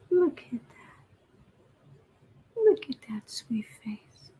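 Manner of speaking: soft whispering coo to a pet. Real, not acted. Greeting her cat mid-stream, whispered baby talk. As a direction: whisper sweetly to the kitten.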